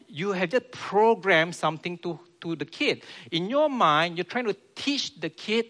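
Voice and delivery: ordinary talk, nothing marked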